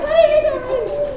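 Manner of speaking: high, loud and drawn out in disbelief; a scandalised shriek at the husband; what on earth, stretched high; shocked exclamation. Retold or imitated. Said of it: "and I'm like".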